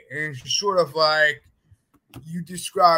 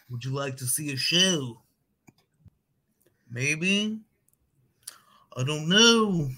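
a lightly done goofy parody of a Liverpool voice, hesitant; shy, goofy street performer offering a show. Retold or imitated. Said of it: "a really goofy voice".

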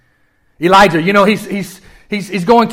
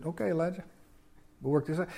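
soft God voice; 16 dB quieter, faster than usual. Pretend.

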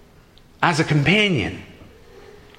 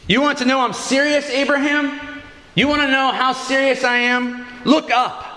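lightly voiced God, higher-pitched and insistent; God challenging Abraham; 7 semitones higher than usual. God assuring Abraham he is serious, insistent and raised. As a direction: press the challenge home, pitched up.

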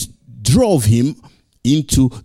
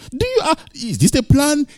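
higher, hesitant son; voice of Jesus. Pretend. Preacher voicing Jesus unsure of the plan, higher and hesitant. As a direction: stammer the question up high.

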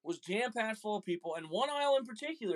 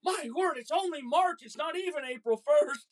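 put-on high, louder prim old-lady voice; flustered old lady; 8 semitones higher, 4 dB louder than usual. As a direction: mimic a rattled grandma scolding. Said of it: "She goes".

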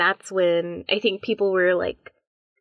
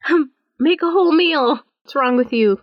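raised, higher-pitched mock telling-off; mock scolding; 6 semitones higher, 5 dB louder than usual. Friends jokingly berate a streamer who won't cook, mock scolding. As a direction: play at yelling at the TV.

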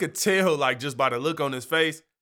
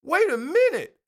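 alarmed surprise; 10 semitones higher than usual. Retold or imitated. Realising the neighbor has been watching him, high startled alarm. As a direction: catch him spying and recoil. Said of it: "I'm like".

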